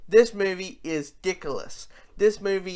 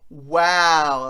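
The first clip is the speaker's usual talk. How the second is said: impressed wow; 8 dB louder than usual. Retold or imitated. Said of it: "you're like".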